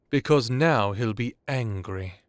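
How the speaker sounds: ordinary talk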